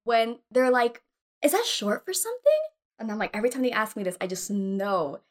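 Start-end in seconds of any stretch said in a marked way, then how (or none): 1.4-2.6 high-pitched put-on curiosity; mock innocent inquiry; nosy questioner. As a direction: ask in a higher, prying voice. Voicing a nosy casting person.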